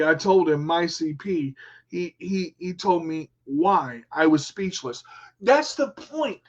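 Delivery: usual speaking voice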